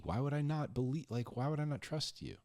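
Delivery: usual speaking voice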